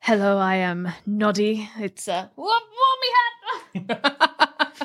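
slow Australian drawl, laughing on the hat; Noddy fumbling his hat, laughing Australian drawl; Noddy's Australian voice. Pretend. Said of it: "Noddy would speak like that".

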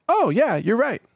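high-pitched mock realization; lightly acted climb-down; sudden concession. AI engine admitting the host was right, mock sudden realization.

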